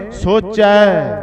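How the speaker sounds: ਸੋਚੈ drawn out very slowly, ending on ai; pronunciation demo. Shown as a demonstration. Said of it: "ਹੋਵੀ ਨਹੀਂ ਕਹਿਣਾ". (ਸੋਚੈ ਦਾ ਸਹੀ ਉਚਾਰਨ ਹੌਲੀ ਹੌਲੀ)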